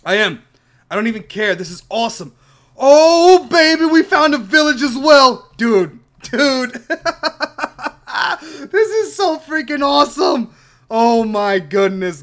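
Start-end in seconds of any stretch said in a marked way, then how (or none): 2.8-6.8 much higher and louder, yelling with joy; ecstatic shout. Real, not acted. Gamer spots a village, shouting to viewers in joy. 6.9-8.4 high, delighted burst of laughter; joyful laugh. Real, not acted. Gamer laughs at finding the village, delighted. 8.4-12.2 higher, slow, stretched-out joy; overjoyed. Real, not acted. Gamer tells viewers how thrilled he is, overjoyed.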